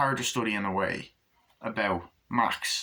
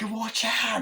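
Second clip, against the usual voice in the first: mock alarm; 12 semitones higher than usual. People shouting at a baby on road.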